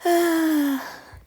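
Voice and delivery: long, loud falling sigh. Real, not acted. Reader reacting to own British accent slip, sighing. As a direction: let out a big exasperated sigh.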